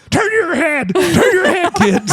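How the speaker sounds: frantic mom. Retold or imitated. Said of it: "my mom turned around like".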